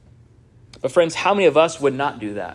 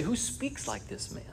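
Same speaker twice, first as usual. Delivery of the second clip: hushed awe; 8 semitones higher, 15 dB quieter than usual. Retold or imitated. Amazed crowd quoted.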